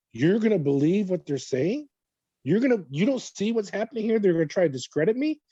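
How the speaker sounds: high, rising questions of disbelief; retold incredulity. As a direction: reenact an indignant appeal, voice climbing at the ends. Retold disbelieving protest to prosecutor.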